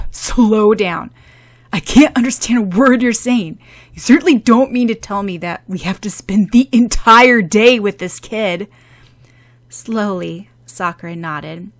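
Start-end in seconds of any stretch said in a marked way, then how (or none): 0.1-8.7 exasperated. Acted. Exasperated boyfriend demands.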